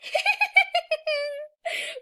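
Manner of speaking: high, loud giggling burst; real delighted laughter; gleeful laugh. Real, not acted. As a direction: cackle with delight. Excited about playing the beast.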